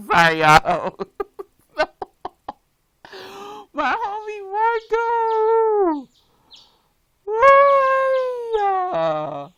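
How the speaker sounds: high wailing with laughter; mock wailing. Real, not acted. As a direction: ham up the heartbreak. Mourning Marco, laughing.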